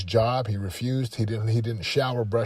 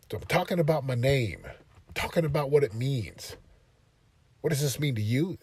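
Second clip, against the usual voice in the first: usual speaking voice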